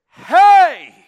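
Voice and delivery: sudden loud, high shout; retold father's urgent holler; calling out. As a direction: shout to catch a child's attention. Father calls out to lost son.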